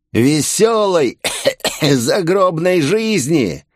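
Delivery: slow, high drawl broken by a cough; creepy dying villain. A narrator's reading. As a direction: gloat ghoulishly through a cough. Wounded villain with detonator says farewell. (жуткий протяжный голос с кашлем)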